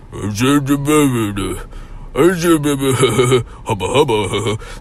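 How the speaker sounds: slurred garbled mumble imitating drugged speech; mumbled Stallone slur. Drugged son showing how he sounded, slurred mumble. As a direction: mush the sounds together like a sedated Stallone. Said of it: "I sounded like fucking Sylvester Stallone".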